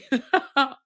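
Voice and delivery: a quick high burst of real laughter. Real, not acted. Laughing at her own rambling to her viewers. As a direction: break into a bright giggle.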